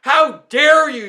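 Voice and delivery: usual speaking voice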